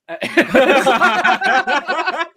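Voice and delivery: cracking up loudly; loud high laugh. Real, not acted. Coaster goes round again.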